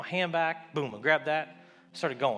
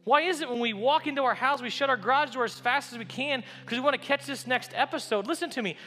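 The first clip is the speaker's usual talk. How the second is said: pitched higher, questioning the crowd; a preacher pleading with his church; rising sermon appeal; 7 semitones higher than usual. Acted. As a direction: press the congregation with each question.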